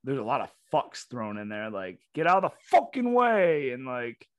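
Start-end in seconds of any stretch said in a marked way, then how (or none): 2.2-3.7 loud, high mock New Yorker; bad New York accent. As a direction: shout it like a caricature New Yorker. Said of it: "and New York, it, it is very".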